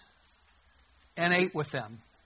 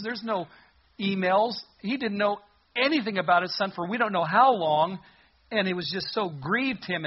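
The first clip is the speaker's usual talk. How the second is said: ordinary delivery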